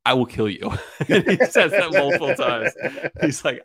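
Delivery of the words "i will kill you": deadpan mock threat, firmly voiced; imitating a deadpan warning. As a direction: deliver an absurd threat completely straight. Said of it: "like, deadpan".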